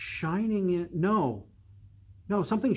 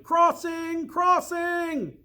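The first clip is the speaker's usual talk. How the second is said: much higher, louder shout; 13 semitones higher, 4 dB louder, much slower than usual. Acted. Crowd shouting the crossing from the gate. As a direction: yell the news from the walls. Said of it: "Voices shouted".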